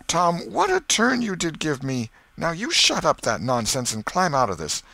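high, emphatic aunt; scolding. Acted. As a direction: scold briskly after a fright. Relieved aunt scolds Tom.

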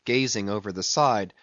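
plain reading voice

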